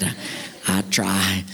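low, bashful country voice; old farmer. Acted. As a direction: give in like a shy old farmer. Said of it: "Billy was this old farmer".

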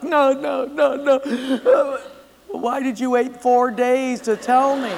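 high half-laughing, half-crying no; boy baffled by grandpa; tearful boy. Acted. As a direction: plead through tears.

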